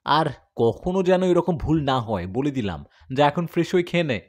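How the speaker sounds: father loudly scolds son over a fight; father scolding. Acted. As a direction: bark at the boy like a strict father. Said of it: "বাবা ধমক দিয়ে বলল".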